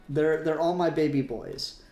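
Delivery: normal talking voice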